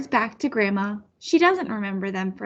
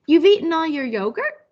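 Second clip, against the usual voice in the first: put-on child guessing; 5 semitones higher than usual. Child guessing grandma's word.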